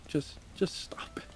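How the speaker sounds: mock whispered plea; a hushed begging "just stop it". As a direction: breathe it out unvoiced, pleading. Whispered plea during gameplay.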